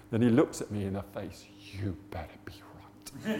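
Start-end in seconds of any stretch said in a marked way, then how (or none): 1.8-3.4 low, menacing whisper; whispered warning. Retold or imitated. Workmate warning Rog face to face, whispered.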